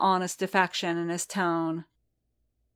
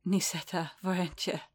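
quiet, soft murmur; mumbled softly; 6 dB quieter than usual. A narrator's reading. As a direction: murmur it, barely audible. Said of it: "Din mumbled".

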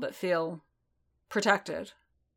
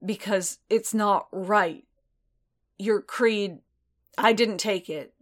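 louder, slow and strained; a little ragged; 2 semitones higher, 4 dB louder than usual. A narrator's reading. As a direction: hold back rising emotion. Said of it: "his voice a little ragged now".

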